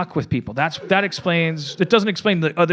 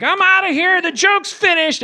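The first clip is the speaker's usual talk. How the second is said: squeaky seal; 4 dB louder than usual. Acted. The seal storms off.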